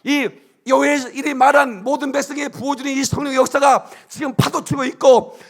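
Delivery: loud, high fervent preaching, rising to shouts; booming revival sermon delivery; full-force preaching. Acted. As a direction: preach it out to the whole congregation.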